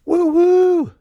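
excited woo. Real, not acted. Co-host greeting entrance cheer.

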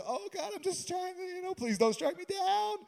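high, squealed mock pleading with God; hammed-up panic, begging to be spared; mock-fearful prayer. Mocks fearful legalist's prayer.

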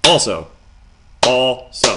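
Also split into two punched beats, loud; syllable demo. Shown as a demonstration. To listeners, proving Also has two syllables, loud and punched. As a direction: clap out each syllable of Also. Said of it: "you clap it".

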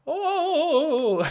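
high, very loud ghostly wail into a laugh. Pretend. Mocking old people as ghosts, loud high wail. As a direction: wail like a ghost then crack up.

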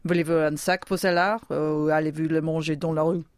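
bored French shopkeeper question. Pretend. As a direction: ask it as a French cake seller. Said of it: "Say something else".

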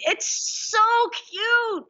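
high, loud, drawn-out squeal of delight; gushing. Real, not acted. Fan reacting to the king's penguin story, real gushing delight. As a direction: gush over it, stretching the words out.

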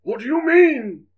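high, loud, slow frightened question; alarmed. Acted. Santa confronted by Sarah: frightened high question. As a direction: ask it with rising dread.

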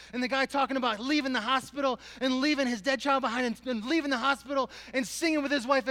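high, loud, impassioned testimony; full-force preaching. Real, not acted. Preacher telling how a hymn broke him, impassioned full-force preaching. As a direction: preach it at full voice, driving every phrase.

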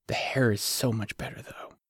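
soft unvoiced whisper of a teasing remark. A narrator's reading. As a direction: lean in and whisper it. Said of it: "she whispered".

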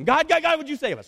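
high, loud mock plea, easing off; hammed-up desperate appeal to God; crying out. Preacher acts bitten Israelites begging God to save them, high cry. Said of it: "So they cry out to him".

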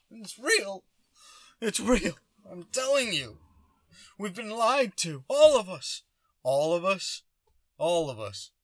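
high, loud, hammed-up anguished insistence; mock emotional outburst. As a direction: plead like a choked-up flat earther. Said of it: "get choked up and emotional".

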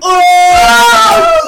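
very high, loud, long "Oh"; mock-scandalised yell. Reacting to a co-host's innuendo, howling shout. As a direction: howl it out over everyone.

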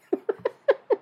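a quick high-pitched giggle; high laugh. Real, not acted. Laughing at his own Deadpool joke, high giggle. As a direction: burst out in a short high laugh.

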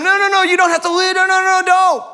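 high, loud pleading no's; frantic followers crying out; protesting followers shout. Acted. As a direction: beg him not to go, shouting.

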